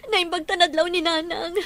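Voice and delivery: words breaking with tears, pushed out loud; tearful. Acted. A mother tells her husband about their baby, crying.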